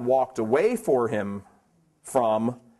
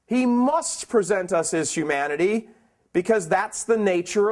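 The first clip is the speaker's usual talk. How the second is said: full-force preaching; 5 semitones higher than usual. Real, not acted. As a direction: drive the point home to the congregation.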